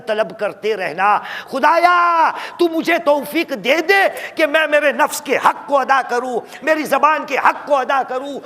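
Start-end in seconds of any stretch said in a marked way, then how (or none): 1.5-8.4 high, loud plea to God; impassioned closing prayer; fervent prayer. Acted. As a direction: cry out a supplication. Preacher prays aloud.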